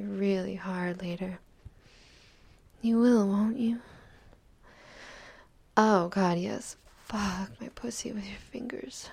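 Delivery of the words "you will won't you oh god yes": louder, breathless exclamation of pleasure; sudden loud passionate cry; passionate outburst. Acted. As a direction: let it burst out louder, swept away. Passionate moment in roleplay.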